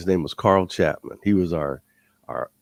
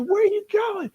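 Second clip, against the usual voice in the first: high shout after a runner; shouted after him. Retold or imitated. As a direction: yell it across the field. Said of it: "Carl's like".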